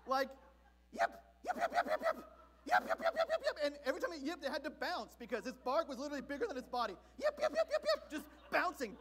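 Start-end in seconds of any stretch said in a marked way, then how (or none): 0.9-3.6 high, loud yippy little dog bark; yapping chihuahua. Retold or imitated. 7.2-8.1 high, loud yippy little dog bark; yapping chihuahua. Retold or imitated.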